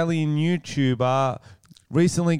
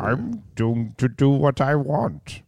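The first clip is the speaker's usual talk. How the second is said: Sean Connery. Pretend. S0 voicing Connery's sexism.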